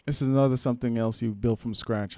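normal talking voice

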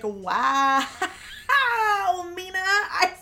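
teasing daughter about favorites, laughing; laughing mock jealousy. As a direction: laugh then slow teasing complaint. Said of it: "we've known ... loves Bart more".

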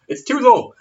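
loud, high yelped complaint; high mock outcry. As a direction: cry it out high in mock protest. Joke shout, then contrasted reply.

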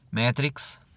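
normal talking voice

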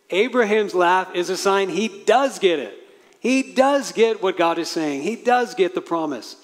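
„does“ jedes Mal betont und laut; stress on does. Acted. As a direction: hit the word does each time. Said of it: "repeated emphatic does".

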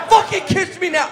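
loud, high, yelled demand; shouted demand. Acted. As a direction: bellow it at him. Repeated as a shout.

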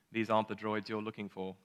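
put-on soft, understated British accent; non-rhotic British movie-hero delivery. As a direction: quote it calmly in a British accent. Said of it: "in that great British accent".

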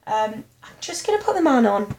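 high, sing-song retold phone voice; breezy. Telling mum to come while fading out, determinedly breezy. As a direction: keep it airy for mum's sake. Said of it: "I've been breezy".

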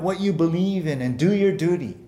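ordinary talk, nothing marked

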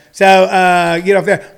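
ordinary talk, nothing marked

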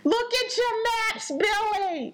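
slow, high mock plea breaking into laughter; hammed-up wife voice dissolving in giggles; laughing through the impression. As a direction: try to stay in character but crack up. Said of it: "Don't do her voice again".

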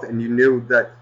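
ordinary talk

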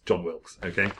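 ordinary talk

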